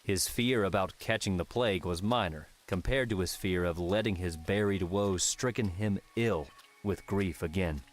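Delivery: ordinary delivery, nothing marked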